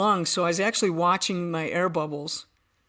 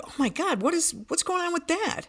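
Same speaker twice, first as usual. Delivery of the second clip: high, alarmed disbelief; suddenly confused by the water in the boat; startled; 11 semitones higher than usual. Retold or imitated. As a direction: voice jumps up in shock. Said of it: "we're like".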